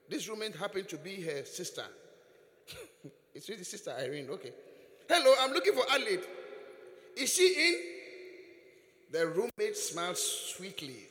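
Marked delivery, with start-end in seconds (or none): 5.1-8.0 fast, loud, higher hello at the door; loud, fast visitor calling at a door; loud eager greeting. Retold or imitated. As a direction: call in brightly and quickly.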